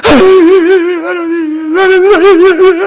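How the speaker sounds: slow, high, wailing cry to God. Acted. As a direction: sob out the name in anguish, drawn out. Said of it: "막 눈물에 쏟아내면서".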